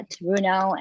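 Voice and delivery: normal talking voice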